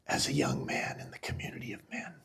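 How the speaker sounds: near-whisper, voice giving out with emotion; low, barely voiced, overcome by the memory; choked up. Real, not acted. Father recalling the men welcoming his sons, choked up. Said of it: "I'm gonna get emotional talking about this".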